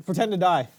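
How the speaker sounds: ordinary delivery, nothing marked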